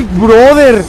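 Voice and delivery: normal talking voice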